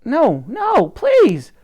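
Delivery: high, loud desperate plea; pleading. A narrator's reading. Elsie begging the gang not to poke her other eye, high pleading cry. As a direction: beg them to stop. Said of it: "pleaded Elsie".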